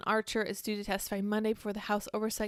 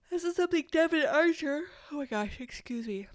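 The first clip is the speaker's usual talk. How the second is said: words stretched by a yawn; 6 semitones higher than usual. Real, not acted. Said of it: "Heartburn and yawning again".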